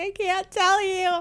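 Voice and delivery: high laughing plea; laughing through it. Retold or imitated. Telling husband she can't say, high and laughing. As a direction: giggle while refusing to tell.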